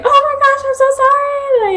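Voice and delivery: very high, squeaky imitation of apologizing; mock flustered apology in a tiny high voice; flustered sensei. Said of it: "my sensei got so flustered".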